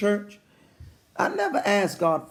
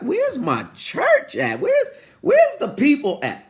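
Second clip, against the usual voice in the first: calling out; 8 semitones higher, 4 dB louder than usual. Real, not acted. Preacher asking where his church is, high stretched calling out. Calls out to silent congregation.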